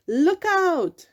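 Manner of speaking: high, loud alarm call. A narrator's reading. Jagged-finned fish warning the school, loud alarm call. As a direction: shout the warning. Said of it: "he called".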